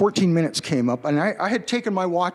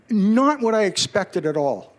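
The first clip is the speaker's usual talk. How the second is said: usual speaking voice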